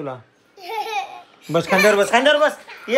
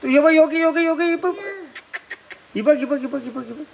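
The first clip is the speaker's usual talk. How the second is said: high sing-song coaxing; 6 semitones higher than usual. Real, not acted. Coaxing child to take keys.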